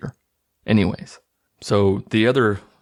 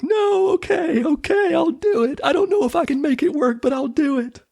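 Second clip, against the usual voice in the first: much higher, panicky mock surrender; mock desperate giving in. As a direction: squeak it out like a cornered kid giving up. Kid caves in to torture threat.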